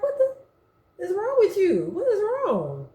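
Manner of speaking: high, half-laughing what is wrong; shocked, with a laugh; alarmed disbelief. Retold or imitated. Sister to crying brother, high startled.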